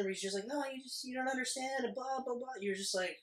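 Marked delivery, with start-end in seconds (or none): 0.5-2.5 higher, softer mock pleading; soft, weaselly mock protest; slimy imitation. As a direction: imitate a slimy excuse-maker, trailing into blahs. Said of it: "he's all slimy at the end".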